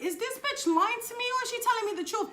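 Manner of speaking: a high, shocked voice in her own head; incredulous inner voice. Retold or imitated. Wondering whether the stranger is lying, high incredulous voice. Inner doubt about the ex's news.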